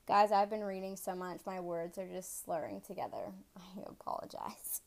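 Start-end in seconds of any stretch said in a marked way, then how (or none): 3.6-4.8 soft sheepish whisper; whispered apology. Real, not acted. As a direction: breathe out a sorry.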